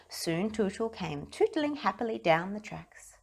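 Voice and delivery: ordinary delivery, nothing marked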